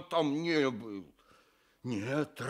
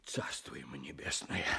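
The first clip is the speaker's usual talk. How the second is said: hushed whisper for the dead; a soft, voiceless whisper; whispered blessing; 10 semitones lower, 10 dB quieter than usual. Real, not acted. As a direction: whisper it as a prayer.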